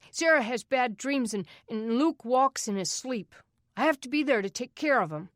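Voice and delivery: put-on a boy defending his siblings, voice rising; upset outburst. Boy bursts out defending family.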